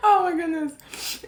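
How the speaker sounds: high, slow laughing through tears; half sob half laugh, overwhelmed; laugh-crying. Real, not acted. Vlogger overwhelmed after admitting she'd cry, laugh-crying. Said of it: "I knew I was gonna cry".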